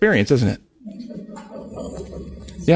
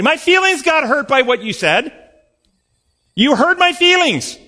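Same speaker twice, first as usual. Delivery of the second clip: mock hurt whine; 10 semitones higher than usual. Mocking an offended person.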